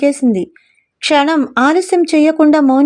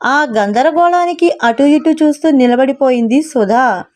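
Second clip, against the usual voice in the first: ordinary delivery, nothing marked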